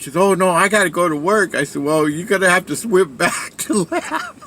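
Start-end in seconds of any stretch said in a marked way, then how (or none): 0.2-1.5 higher-pitched imitation of alarm; raised, mock-distressed voice; mock freaking out. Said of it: "freaked out". 1.8-4.4 higher voice breaking into laughter; retold wisecrack dissolving into a laugh; laughing tease. Teasing friend, breaks into laughter.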